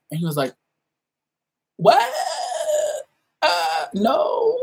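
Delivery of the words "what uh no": shocked. Retold or imitated. His shocked reply retold.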